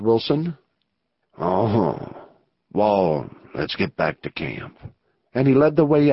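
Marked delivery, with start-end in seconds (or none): 1.4-4.8 a much lower, slow-speaking western outlaw, wary. Acted. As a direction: play the rough outlaw weighing the risk. Narrator voices outlaw Anson.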